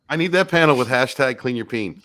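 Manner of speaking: normal talking voice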